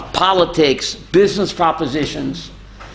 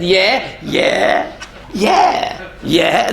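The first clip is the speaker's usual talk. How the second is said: smiling, distracted yeahs going up and down; smiling absent yeahs; much slower than usual. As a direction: humor him with vague yeahs. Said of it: "shmeichel and said".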